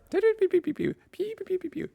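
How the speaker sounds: rapid squeaky laser sounds; very high piping "pew" imitations; playful squeaked laser zaps; high pew-pew noises. Real, not acted. Makes noises to test transcription.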